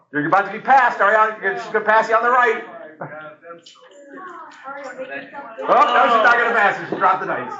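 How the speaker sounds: high, fast race calling; excited commentary. Real, not acted. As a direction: call the race breathlessly.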